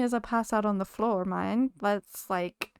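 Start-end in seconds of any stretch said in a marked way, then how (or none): none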